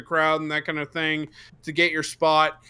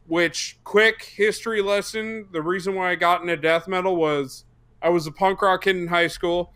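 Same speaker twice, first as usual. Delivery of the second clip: ordinary delivery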